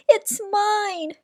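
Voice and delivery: high, loud claim; shout. A narrator's reading. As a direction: shout it's mine at him. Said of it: "shouted Cyril".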